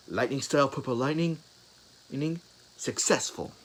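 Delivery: proud announcement. Acted. Hero announces his new technique.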